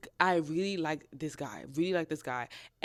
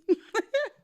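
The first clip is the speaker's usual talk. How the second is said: high giggle; 3 dB louder than usual. Real, not acted. Laughing at own joke.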